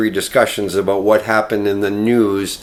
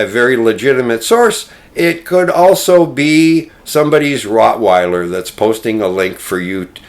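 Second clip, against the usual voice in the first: ordinary talk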